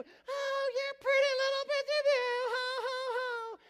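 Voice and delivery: very high, loud gushing coo; hammed-up mom fussing over baby; mother's baby talk. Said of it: "You gotta be like that mother".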